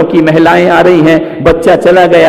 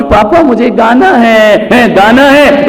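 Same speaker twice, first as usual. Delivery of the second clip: put-on whiny child; 7 semitones higher, 3 dB louder, slower than usual. Child whining insistently.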